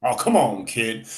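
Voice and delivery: normal talking voice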